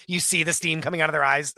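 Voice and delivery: ordinary talk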